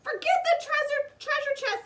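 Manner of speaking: urgent. A narrator's reading. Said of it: "said Annie".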